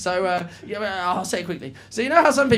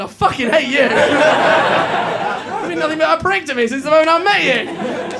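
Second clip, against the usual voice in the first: loud, high-pitched comic rant; hammed-up fury, raised voice; mock angry outburst; 7 semitones higher, 6 dB louder than usual. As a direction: pretend to lose it at him, building higher. Acted outburst at the fan.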